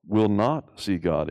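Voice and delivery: ordinary talk, nothing marked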